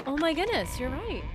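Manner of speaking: ordinary delivery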